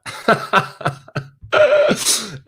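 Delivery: a high burst of real laughter; cracking up at a viewer's comment. Real, not acted. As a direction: laugh out loud at the joke. Laughing at Nigella suggestion.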